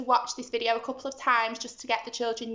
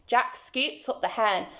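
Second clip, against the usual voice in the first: ordinary delivery, nothing marked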